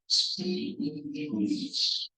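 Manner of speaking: "speech" stretched very long, cheeks pulled back; exaggerated long ee. Shown as a demonstration. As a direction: hold the ee in "speech" as a demonstration. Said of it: "pull your cheeks back like".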